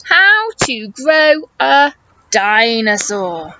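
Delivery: slow, word-by-word read-along of the title. Shown as a demonstration. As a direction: spell it out slowly so the kids can join in. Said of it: "Will you help me read the title".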